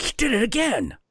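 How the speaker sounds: put-on a small boy realising he's been robbed again; little kid voice. Little Viking to himself, a lightly voiced indignant kid.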